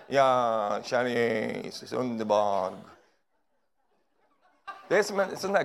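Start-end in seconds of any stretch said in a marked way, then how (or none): 0.1-2.7 low, slow holy voice; mock pious sigh. Preacher mocking pious churchgoer, low drawn-out voice. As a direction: sigh it out devoutly. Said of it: "håller du på så när du går och handlar".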